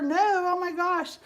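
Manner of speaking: high, slow, hammed-up dismay; playing the friend who has no order either; mock dismay. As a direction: wail it like the news is dire. Friend's panicked reply.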